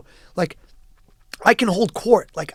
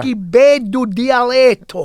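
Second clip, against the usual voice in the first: slow, lilting Sicilian Italian, louder; a relative admiring his dialect, slow Sicilian; 3 semitones higher, 5 dB louder, slower than usual. Retold or imitated. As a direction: savor each word in Sicilian. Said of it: "Italians would say".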